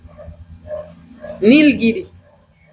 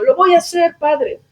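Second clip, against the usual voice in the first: high, insistent threat; desperate daughter; 9 semitones higher than usual. Retold or imitated. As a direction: sound ready to do it. Daughter threatens to take poison. (hija desesperada, voz muy aguda)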